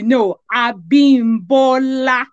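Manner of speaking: "Abimbola" slow, each syllable toned; tones and accent. Shown as a demonstration. Mother correcting kids on her name, full tones. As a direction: draw out "Abimbola" with its Yoruba tones. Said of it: "with the tone, with the accent".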